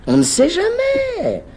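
slow, high, singsong teasing; mock high voice. Teacher teasing his shy colleague in a high slow voice. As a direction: stretch it out in a high teasing falsetto.

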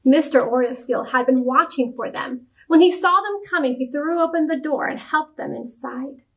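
usual speaking voice

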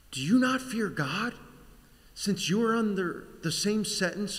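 high, loud rebuke, easing as it goes; yelling. Acted. Criminal on the cross yells at the other one, loud rebuke. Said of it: "yells to the other".

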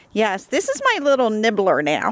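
normal talking voice

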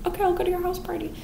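high soft quick reply; high meek Lucy. Retold or imitated. Narrator voicing Lucy accepting the judge, small high and soft. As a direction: agree timidly in a small high voice.

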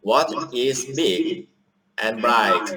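reading a simple English text slowly and loudly, word by word; a teacher playing a student reading into the app; slow loud read-aloud. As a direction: sound out each phrase like a pupil reading aloud. Retake of the same reading.

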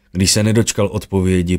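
usual speaking voice

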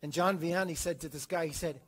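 ordinary delivery

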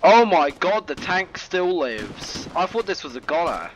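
loud amazed exclamation; surprised. Real, not acted. Tank surprise.

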